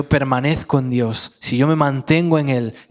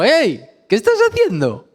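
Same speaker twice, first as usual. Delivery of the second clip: high, lively call with a laugh; high amused wake-up call; Jesus waking him; 6 semitones higher than usual. Retold or imitated. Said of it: "le dijo".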